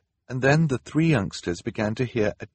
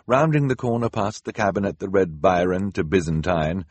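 ordinary delivery, nothing marked

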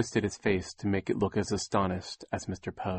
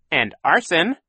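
higher, louder cheeky villain; chirpy proud villain; Count Olaf piping up; 8 semitones higher, 8 dB louder than usual. A narrator's reading. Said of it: "Count Olaf piped up".

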